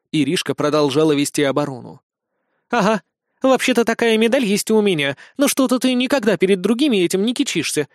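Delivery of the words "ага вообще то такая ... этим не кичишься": mildly huffy, raised pitch; a touch of sisterly scorn; snorting retort. A narrator's reading. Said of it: "фыркнула сестра".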